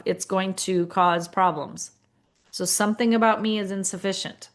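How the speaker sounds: ordinary talk, nothing marked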